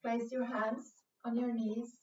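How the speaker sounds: ordinary delivery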